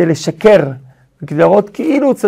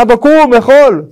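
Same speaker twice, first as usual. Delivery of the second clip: louder, higher, brusque order to father; disrespectful demand; 6 semitones higher, 5 dB louder than usual. Retold or imitated. Esau rousing his father to eat, disrespectfully. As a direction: imitate a rude son ordering his father up. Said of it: "הוא לא דיבר כבר בצורה מכובדת".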